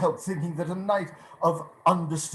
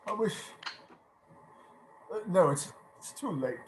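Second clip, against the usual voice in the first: trailing off softer into quiet; choked regret; 4 semitones higher, 4 dB quieter, faster than usual. Acted. Scrooge regretting the caroler, fading away. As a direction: let the regret swallow the words.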